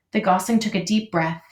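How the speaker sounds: usual speaking voice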